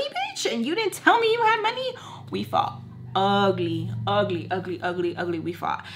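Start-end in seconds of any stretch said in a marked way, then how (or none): 0.0-1.9 high, indignant yelled quote; retold fight, voice jumping up; mock angry accusation. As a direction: throw the accusation back, pitched up. Quoting angry partner, pitch jump.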